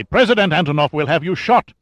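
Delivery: loud, high angry threat. A narrator's reading. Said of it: "Lugovoy said angrily".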